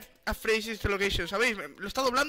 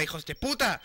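cursing burst. Real, not acted. Swearing at the animatronics.

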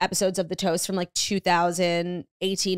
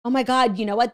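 ordinary talk, nothing marked

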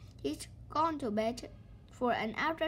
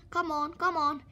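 louder, urgent come on; impatient cry; 3 semitones higher, 6 dB louder than usual. Acted. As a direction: call through the door. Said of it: "he cried".

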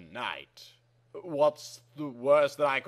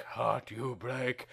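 softer, lower, slow echo; quiet echo; 4 semitones lower, 11 dB quieter, slower than usual. Acted. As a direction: let it fade back as an echo. Echo of the line before.

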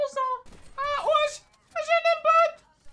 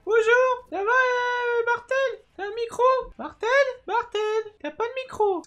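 much higher put-on squeaky voice; high troll voice; 6 dB louder than usual. Trolling random teammates in Fortnite, high put-on voice. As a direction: play a fake little kid to fool teammates. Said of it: "je vais essayer de faire une petite voix".

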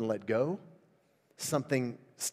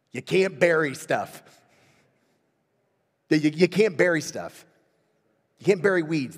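louder, emphatic correction; firm insistence; 4 semitones higher, 9 dB louder than usual. Retold or imitated. Preacher telling the sobbing woman firmly, louder insistence. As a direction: hammer home that you can't bury it.

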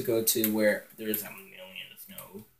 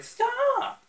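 mom yelling; 3 dB louder than usual. Retold or imitated. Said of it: "She was like".

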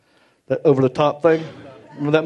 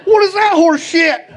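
high, slow, loud disbelieving yell; a friend's indignant shout; outraged yell; 7 dB louder, slower than usual. Retold or imitated. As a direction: bellow it in mock outrage. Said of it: "He's like".

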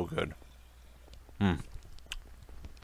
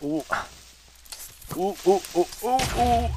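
high hooting monkey "ooh ooh"; monkey impression; 13 semitones higher, 3 dB quieter than usual. Gamer playing monkey for viewers, hooting impression. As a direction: hoot like a little monkey. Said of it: "That's my monkey impression".

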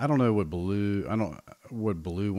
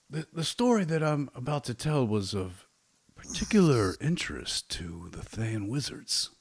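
higher-pitched NPC storyteller; 6 semitones higher, 3 dB louder than usual. Acted. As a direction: tell the tale in character.

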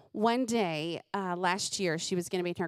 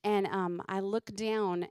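normal talking voice

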